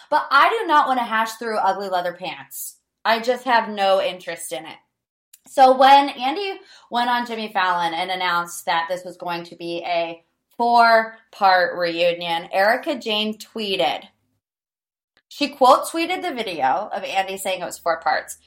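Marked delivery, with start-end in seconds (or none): none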